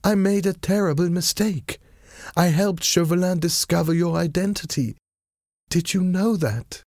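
high-pitched lady confessing; distressed lady. A narrator's reading. Wife confessing a mistake to her husband, high-pitched and earnest. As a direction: plead it in a high feminine voice.

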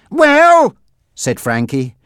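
high, loud, sharp demand; impatient gangster Frankie. Acted. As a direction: demand an answer as the gang boss.

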